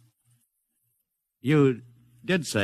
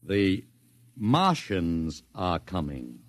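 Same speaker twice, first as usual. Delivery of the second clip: reading the teaser; 8 semitones lower, much slower than usual. Acted. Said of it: "that's not a bad teaser".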